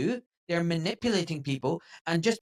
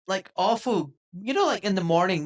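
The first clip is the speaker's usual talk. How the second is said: ordinary talk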